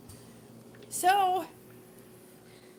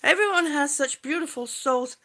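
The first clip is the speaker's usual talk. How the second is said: ordinary talk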